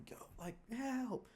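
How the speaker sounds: very high, slow whispered plea for help; faint high whisper. Retold or imitated. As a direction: breathe out a tiny helpless call. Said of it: "I'm like".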